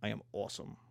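low menacing boast, dropping away at the end; Hulk-like strongman. Acted. Ripped former weakling to scared beachgoers, low mock menace. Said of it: "the Hulk".

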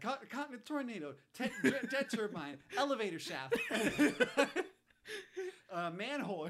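high, loud list through laughter; real giggling breaking into the words; laughing. Real, not acted. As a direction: crack up while reeling off the items. Listing absurd hero deaths while laughing.

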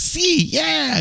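very high, drawn-out squeal of agreement; little guy impression. Little Spanish-speaking guy answering Brando, high mock agreement. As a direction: put on a tiny high voice, agreeing blankly. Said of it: "you know, like that".

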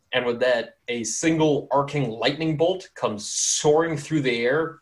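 ordinary delivery

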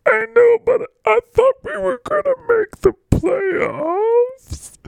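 very high, drawn-out blubbering wail; crying character. Acted. Mister Golf mourns the playoffs, bawling. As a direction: wail through mock sobs, stretching the words. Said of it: "why are you crying".

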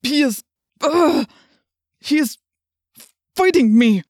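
loud, high, halting strain; words broken by agonized grunts; struggling to speak. A narrator's reading. Chapman fighting his rebellious host, strained halting cry.

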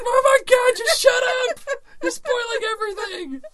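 very high, loud mock scream; hysterical shriek. Imitating prank victim begging spoilers to stop, shrieking. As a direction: wail it like everything is ruined.

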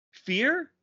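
"Fear?" rising sharply high in disbelief; incredulous. Shown as a demonstration. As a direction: sound baffled, pitch way up on "Fear?". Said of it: "in Negaduck?".

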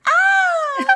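high startled scream on waking; nightmare scream. Acted. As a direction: jolt awake from a nightmare, crying out. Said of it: "wakes up from the nightmare with an".

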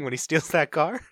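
ordinary talk

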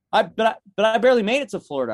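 high, fast, stammered protest; acted-out disbelieving objection. Acted plea after being turned away.

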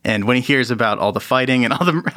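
ordinary talk

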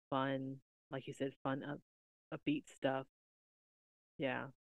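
fading into a mumble; trailing off. Real, not acted. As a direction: let the words die away. Voice fading at end of thought.

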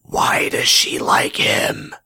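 strained, slow whispered wail; exasperated whisper. Pretend. Host baffled that she likes him, exasperated whisper. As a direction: whisper it like a scream of despair. Exasperated complaint about the storyline.

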